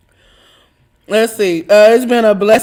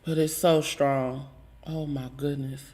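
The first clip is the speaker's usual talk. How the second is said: hushed awe; 11 semitones lower, 15 dB quieter than usual. Real, not acted. Overwhelmed by strong scent.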